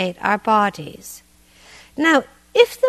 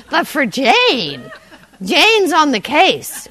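ordinary talk

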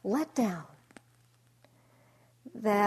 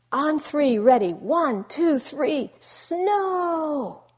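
high, loud count up to a shouted snow; a kid commanding the weather at full voice; yelling; 7 semitones higher, 8 dB louder, much slower than usual. Retold or imitated. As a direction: yell the countdown at the sky. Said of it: "he yells".

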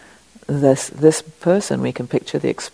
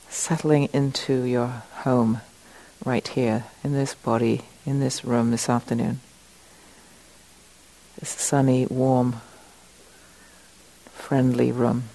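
slightly lowered, soft meditation voice with long pauses; guided meditation; 3 dB quieter than usual. Acted. Teacher settling the group into the room, guided meditation. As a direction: settle them in gently, leaving space between phrases.

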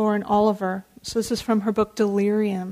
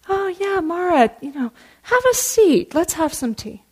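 welcoming; 7 semitones higher than usual. Acted. Buddha welcoming Mara to tea.

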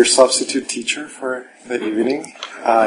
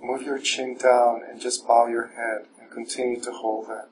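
guided meditation; 7 dB quieter than usual. Acted. Teacher guiding the evening class through standing meditation, soft and calm. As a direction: guide the room gently inward, unhurried.

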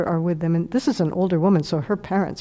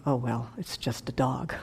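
much softer, lower, offhand quote; a soft, shrugging mock listener; dismissive aside; 6 semitones lower, 10 dB quieter than usual. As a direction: toss it off as if it hardly matters. Imagined listener dismissing the loss.

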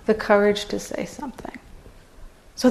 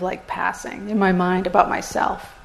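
normal talking voice

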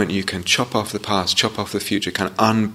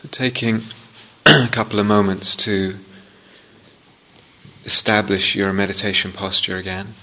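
guided meditation. Real, not acted. Teacher guiding students into upright posture, unhurried meditation voice. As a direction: ease each phrase out, leaving space after it.

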